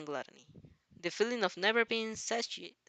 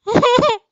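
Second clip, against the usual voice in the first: real laugh; 18 dB louder than usual. Real, not acted. Reader laughs at own slip.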